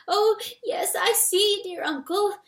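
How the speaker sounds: high, tight little mouse squeak. A narrator's reading. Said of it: "Freytag squeaked".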